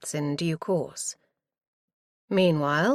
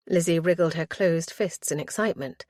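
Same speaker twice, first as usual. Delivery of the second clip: ordinary delivery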